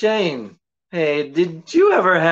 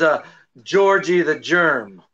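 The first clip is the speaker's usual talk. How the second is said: usual speaking voice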